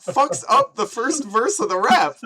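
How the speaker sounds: high, loud outburst; exasperated rant. Real, not acted. Ranting about singer's botched verse.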